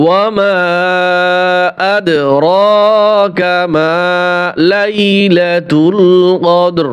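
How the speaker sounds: slow, drawn-out tajweed chant; Quran recitation. Shown as a demonstration. Teacher reciting the verse to students, slow melodic chant. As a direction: sing the verse slowly with long vowels.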